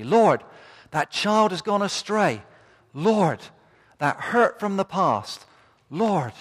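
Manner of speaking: loud, high pleading prayer; crying out to God. Pretend. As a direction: call out to the Lord in desperation. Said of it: "Must cry out".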